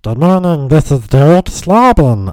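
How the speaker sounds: loud high put-on greeting; altered caller voice. Caller introducing himself to another person, high altered voice. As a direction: sing out a silly, high-pitched caller voice. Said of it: "constantly alter my voice".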